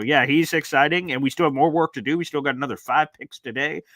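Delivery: ordinary talk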